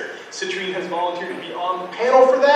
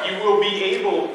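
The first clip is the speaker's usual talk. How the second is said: usual speaking voice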